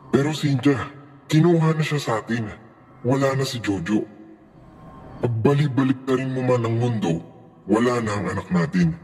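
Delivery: acted marital fight, pressing her to accept it; angry pleading husband. A narrator's reading. Husband pleading with wife to accept their son's death, angry. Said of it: "Never pa akong nasigawan ni Richard".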